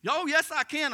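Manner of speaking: high, loud retort; defiant witch. Retold or imitated. Witch contradicting the pastor, high and loud. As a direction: argue back at full pitch.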